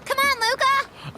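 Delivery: high eager call; calling a friend. Acted. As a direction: urge Luca along. Player character calls companion.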